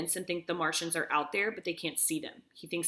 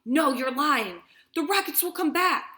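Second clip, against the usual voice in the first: high, loud "No, you're lying" from a father in denial; desperate cry; 9 semitones higher, 7 dB louder than usual. Acted. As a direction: shout it out against the awful news.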